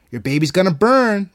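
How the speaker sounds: loud, high taunting threat; menacing threat. A narrator's reading. Evil voice threatening Marigold's baby, loud menace. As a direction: relish the threat, swelling on the last word.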